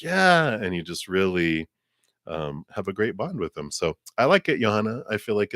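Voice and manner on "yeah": much higher, keen yes; eager yeah. Retold or imitated. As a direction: toss off an eager agreeing yeah. Friend's eager reply, acted quote.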